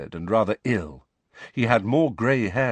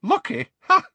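high, incredulous scoff; 7 dB louder than usual. A narrator's reading. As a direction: throw the word back and bark a laugh. Witch scoffs at Harry's remark.

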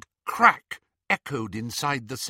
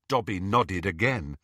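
plain reading voice

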